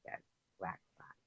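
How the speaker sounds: breathless scared whisper; whispered fear. Real, not acted. Spotting a spider in the corner, scared whisper. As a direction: hush it as if the spider hears.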